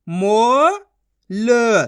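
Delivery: मो drawn out loud and rising, then ल; syllable sounding out. Shown as a demonstration. Teacher answering how to read the matra, sounding out. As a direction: hold मो and ल as separate long sounds. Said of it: "तो अपन माँ को कैसे पढ़ेंगे?".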